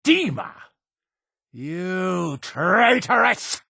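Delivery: high shout, then drawn-out accusation; outraged denunciation. Acted. Leader denouncing Dima and a traitoress, outraged shout. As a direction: cry out the name and condemn.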